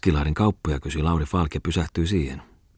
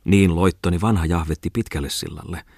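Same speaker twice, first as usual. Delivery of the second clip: plain reading voice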